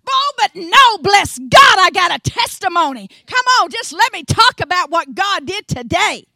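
loud, fervent pulpit preaching; fiery preaching. Acted. Tennessee preacher stirring up her church, loud and fervent. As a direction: preach it full force to the congregation.

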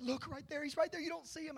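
high-pitched imitation of an insistent little boy; excited six-year-old. Young son insisting that Dad look, high excited child voice. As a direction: play an amazed child pointing something out. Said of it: "At the time, he's six".

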